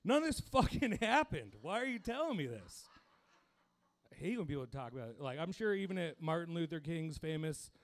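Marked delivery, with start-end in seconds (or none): 0.0-2.9 high, exasperated comic protest; hammed-up fed-up outburst; acted exasperation. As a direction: start loud and high, then plead for sense. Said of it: "I'm like".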